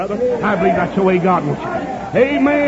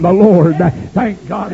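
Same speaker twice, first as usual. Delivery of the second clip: normal talking voice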